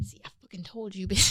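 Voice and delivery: soft whispered aside. Retold or imitated. Kat smugly whispering she told her so.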